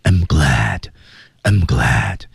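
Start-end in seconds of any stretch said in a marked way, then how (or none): 0.0-0.8 fuller breath, low and breathy. Shown as a demonstration. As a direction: push more air through it. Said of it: "气息再足一点".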